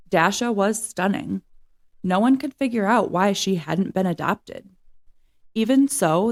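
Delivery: ordinary delivery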